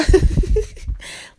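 loud, high burst of laughter; helpless high cackle. Real, not acted. Mocking people who only talk, laughing loudly.

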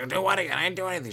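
high, mock-angry denial; pissed-off customer. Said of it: "She was pissed".

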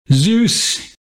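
sharp high yell of a name. Acted. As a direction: yell it up at the sky. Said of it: "he yelled".

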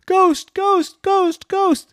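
high, loud repeated alarm; scared cry. A narrator's reading. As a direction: cry out in panic, word after word. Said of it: "she got really, really scared".

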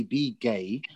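ordinary talk

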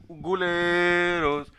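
drawn-out yelled guleros; passer-by heckling the diners; calling out. Retold or imitated. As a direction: call it out long like a street hawker. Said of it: "gritándole".

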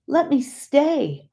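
high, slow, pleading plea. A narrator's reading. Dying wife begging her brother-in-law to stay, high slow plea. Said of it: "I pleaded".

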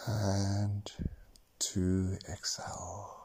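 slow counting, whispered on exhales; slow breath counting. Acted. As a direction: count each breath calmly, long pauses. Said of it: "actually count your breaths now".

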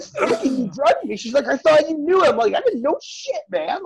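high, loud laughing accusation; hammed-up indignation, squeaking; mock outrage. Retold outrage at being drugged.

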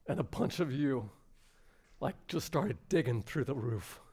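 moved near tears, halting with long pauses; choked up. Real, not acted. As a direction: hold back tears between phrases. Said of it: "I can't watch that without crying".

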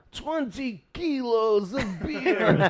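put-on slow, high, drawn-out German accent; German accent, Russian-tinged. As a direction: strain out a thick, vaguely European accent. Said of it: "Your German accent sounds a little Russian".

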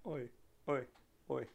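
high, loud call dying away in echoes; mock Aussie shout trailing off like an echo; echoey ghost. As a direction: do your own echo, fading each time. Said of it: "or it gets echoey".

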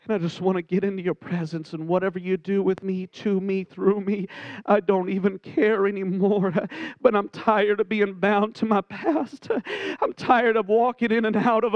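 low, hushed, weary prayer to God. Acted. Voices a worshipper's prayer.

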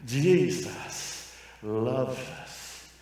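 slow, softened, mock-eloquent preacher; hushed and measured, parodying polite preaching; eloquent demo. Shown as a demonstration. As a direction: demonstrate a genteel sermon with long, careful pauses. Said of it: "said that more eloquent".

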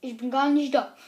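loud, startled outcry; loud outcry at the screen; loud exclamation. Real, not acted. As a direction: call it out loud.

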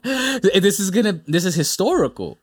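high voice through laughter; laughing. Real, not acted. Trying to make the point to a laughing co-host, amused and high.

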